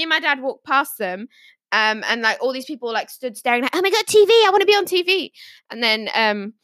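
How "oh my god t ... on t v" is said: higher-pitched, eager mimicry of attention seekers; mock excitement. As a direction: gush like someone desperate to be on TV.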